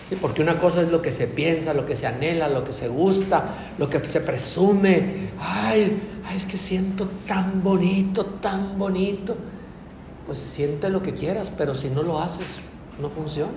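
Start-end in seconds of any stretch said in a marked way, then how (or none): 5.4-9.3 dreamy, drawn-out sigh of mock feeling; mock sentimental. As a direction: gush lightly about how lovely it feels, mocking. (sentimental burlón, alargado y soñador)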